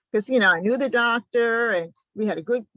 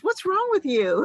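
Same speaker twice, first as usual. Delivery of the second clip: doctor puzzled; 11 semitones higher, 3 dB louder than usual. Retold or imitated. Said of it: "And he goes".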